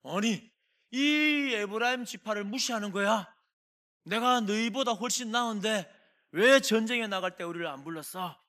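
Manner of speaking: loud, indignant shout at Gideon; angry outburst. Acted. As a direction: blast it like a bully picking a fight. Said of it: "버럭 화를 내면서".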